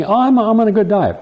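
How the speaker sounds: high, defensive fibbing voice; evasive patient voice. Retold or imitated. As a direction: dodge it, a little too high. Acted patient dodging the question.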